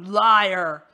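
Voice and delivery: very loud, cutting snarl; furious accusation. Acted. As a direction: slam the word down like a verdict.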